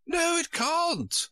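very high, slow, firm queen voice; fussy queen, drawn-out refusal. Acted. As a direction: draw it out high and final. Queen's voice held by reader.

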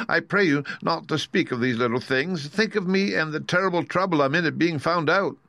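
high-pitched, lightly voiced little man; timid exposed wizard. Exposed wizard pleading over being found out, fretful. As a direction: beg fretfully for sympathy.

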